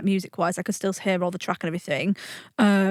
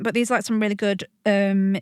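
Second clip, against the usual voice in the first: ordinary delivery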